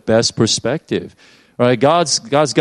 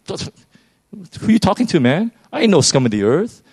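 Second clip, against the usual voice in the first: higher, quick offended comeback from a proud man; indignant retort; 6 semitones higher than usual. Acted. A prideful person snapping back at God, indignant. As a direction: bristle back at the insult, fast.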